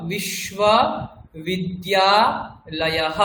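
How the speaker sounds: বিশ্ববিদ্যালয়হ drawn out very slowly, every syllable clear, with a breathy হ at the end; teacher slowly modelling বিশ্ববিদ্যালয়হ in Sanskrit, ending with a breathy হ; Sanskrit pronunciation. Shown as a demonstration. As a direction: spell out বিশ্ববিদ্যালয়হ syllable by syllable, very slow, ending on a voiced visarga হ. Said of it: "লয়াহা বলতে হবে".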